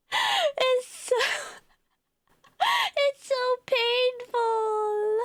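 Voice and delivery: slow words through laughter; wheezing laughter; laughing. Real, not acted. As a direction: cringe and laugh at once.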